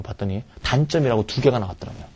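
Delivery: ordinary delivery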